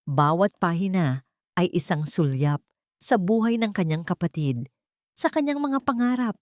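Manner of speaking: ordinary delivery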